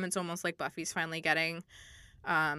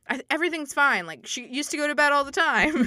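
high, loud mock reassurance; hammed-up imitation of a friend in denial; acted insistence; 7 semitones higher, 6 dB louder than usual. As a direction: insist too brightly, nearly laughing. Acts out Willow insisting all is fine.

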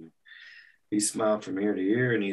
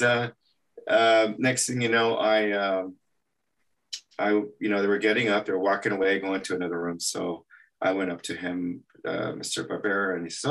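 ordinary talk, nothing marked

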